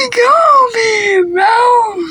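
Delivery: high laughing call; laughing shout. Real, not acted.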